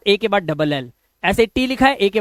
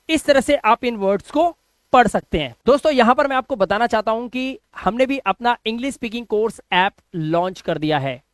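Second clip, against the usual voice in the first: ordinary talk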